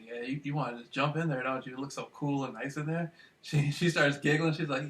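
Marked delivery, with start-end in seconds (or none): 0.0-3.1 softer, low-key chat-up line; teasing a woman at the ice cream freezer, softly; soft flirting. Retold or imitated. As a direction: coax her gently, voice softer.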